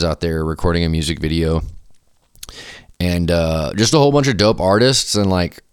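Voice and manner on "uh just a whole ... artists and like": ordinary delivery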